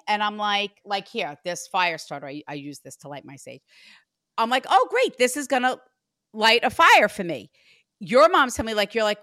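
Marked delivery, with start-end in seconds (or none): 4.7-7.5 mock excited exclamation. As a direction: play up the fake delight, louder. Mock reaction to a fire starter.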